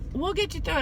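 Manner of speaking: lightly voiced comforting nurse; soothing nurse. Nurse repeating comfort to patient.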